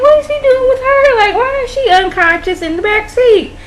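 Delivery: very high-pitched incredulous questioning; hammed-up "what on earth" reaction; baffled disbelief. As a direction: squeal the question in exaggerated bewilderment. Her baffled reaction to the scene.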